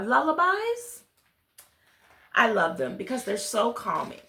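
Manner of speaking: ordinary delivery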